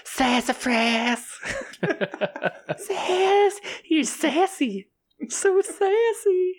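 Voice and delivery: very high, slow hissing s; snake-like hissing. Acted. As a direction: draw out every s like a snake. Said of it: "you sound like a silly snake".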